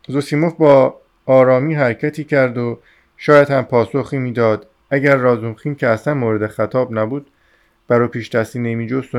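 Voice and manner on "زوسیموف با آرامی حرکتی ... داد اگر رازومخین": plain reading voice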